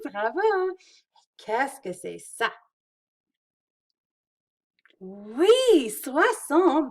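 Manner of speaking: bright quiz with big praise; talking to toddlers. Acted. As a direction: cheer each right answer like a preschool teacher. Quizzing kids, praising answers.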